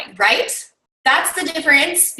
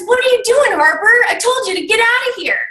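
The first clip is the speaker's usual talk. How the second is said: high, loud, fast yelling coach; angry coach; 10 semitones higher, 3 dB louder, faster than usual. Retold or imitated. Coach yelling at Harper who returned, furious. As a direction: snap at the player who came back. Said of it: "his coach says".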